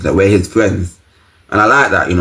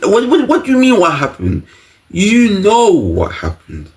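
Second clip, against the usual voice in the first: high-pitched indignant disbelief; acted outrage, voice jumping high; mock incredulous; 11 semitones higher than usual. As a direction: challenge the nurse in a pitched-up voice. Mock outrage answering imitated nurse.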